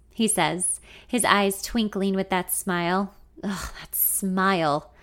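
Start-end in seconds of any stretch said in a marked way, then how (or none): 3.4-4.9 lovestruck groan. A narrator's reading. Narrator swooning over his smile, slow dreamy sigh. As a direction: melt as you say it, slowly.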